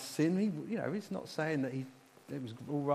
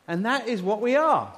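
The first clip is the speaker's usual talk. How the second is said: loud, high declaration building to ARE; triumphant proclamation; 7 semitones higher, 11 dB louder than usual. Real, not acted. Preacher to congregation at the scripture's climax, loud and triumphant. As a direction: proclaim it to rouse the whole room.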